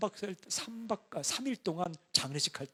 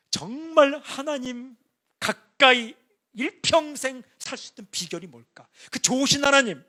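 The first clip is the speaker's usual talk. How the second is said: fervent preaching; 8 semitones higher, 13 dB louder than usual. Acted. Pastor preaches about Joshua to congregation, fervent. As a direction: build to a passionate climax.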